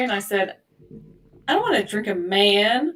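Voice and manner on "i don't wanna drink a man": loud mock childish protest; mock indignant refusal. Young child refusing mom's offer of juice, mock indignant. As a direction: protest loudly, as if baffled.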